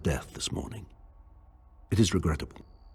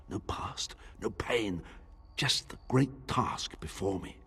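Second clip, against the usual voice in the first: unvoiced, soft hushed words; whisper; 3 semitones higher, 5 dB quieter, slower than usual. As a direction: breathe it like a secret relief. Reverie, whispered.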